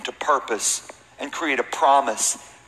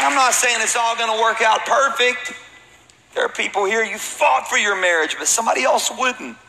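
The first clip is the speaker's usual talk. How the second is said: preaching at full force; 10 semitones higher, 4 dB louder than usual. Acted. Pastor rallies the congregation, preaching at full force. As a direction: lift the whole church with a pastor's roar.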